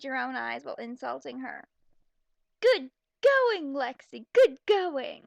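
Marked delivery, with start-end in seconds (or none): 2.6-5.2 sarcastic shout. Real, not acted. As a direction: yell it with biting sarcasm. Sarcastic praise of the character.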